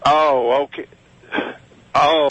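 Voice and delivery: normal talking voice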